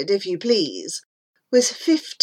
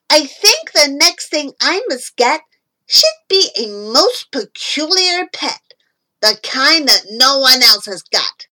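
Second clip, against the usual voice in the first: high, loud burst of inspiration; loud, high-pitched boy's excitement; excited boy; 5 semitones higher, 5 dB louder than usual. A narrator's reading. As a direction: announce a brilliant idea. Boy exclaims a bright idea.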